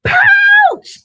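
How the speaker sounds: high, loud frightened cry; scared yell. Acted. Baby kangaroo yells in fright running from the bee, loud cry. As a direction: shriek it as you bolt for home. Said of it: "And he yelled".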